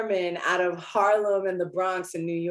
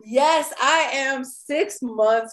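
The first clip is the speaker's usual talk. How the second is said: slow, loud happy reveal; excited; 6 semitones higher, 7 dB louder, slower than usual. Real, not acted. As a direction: savor the big news. Announcing her pregnancy.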